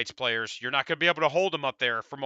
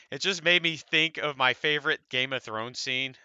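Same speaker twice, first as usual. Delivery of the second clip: usual speaking voice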